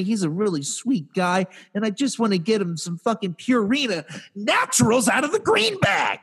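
high, impassioned plea building to a peak; hammed-up desperation. Cat owner desperate to feed his boy, hammed-up plea. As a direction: plead the case with mock desperation. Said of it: "I'm just so desperate".

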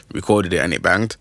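ordinary talk

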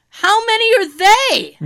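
high-pitched acted alarm; retold disbelief, voice shooting up; mock-horrified question. Acted alarm at several snakes.

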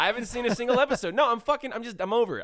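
high, loud, half-laughing rant; worked up. Real, not acted. Telling the co-host to drop the series, worked up. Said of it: "you're gonna trigger me".

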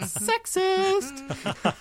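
high, loud mock shout; hammed-up, high-pitched jeer; mock accusation. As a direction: cry it out in exaggerated outrage. Jokey accusation over all-male picks.

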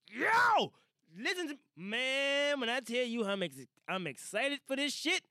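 a loud, high "Yo!" then a slow stretched build of excitement; can't contain the Avengers hype; hyped shout. Real, not acted. Hyped about Avengers release.

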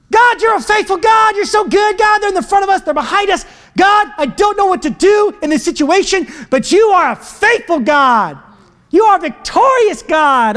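high, forceful shouted prayer; crying out to God. Acted. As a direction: call out desperately in praise. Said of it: "they said".

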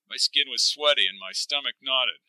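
plain reading voice

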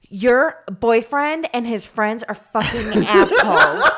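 loud, indignant retold rant; imitated furious complaint; mock outrage. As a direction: punch it out angrily, breaking into laughter. Acts out woman storming over angrily.

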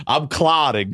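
high, strained retold cry; mock distressed announcement. Patient tells therapist what is wrong, mock high distress.

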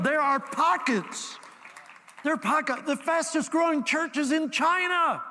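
high, building preacher's cry; impassioned preaching. Real, not acted. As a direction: revival sermon in full flight. Preaches at full force.

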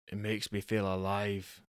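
put-on Angus in a Scottish accent, soft; quiet, earnest Scottish husband; a hint of Scottish brogue. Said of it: "I've got stuck in Scottish".

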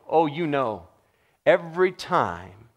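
ordinary delivery

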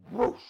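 a loud rushing whoosh sound. Real, not acted. Showing his awareness bursting open, loud whoosh. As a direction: blast out a whoosh like wind.

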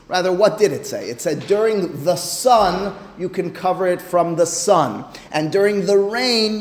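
ordinary delivery

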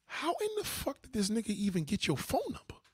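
can't believe who she picked; incredulous outburst. Retold or imitated. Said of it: "I look at ... and I say".

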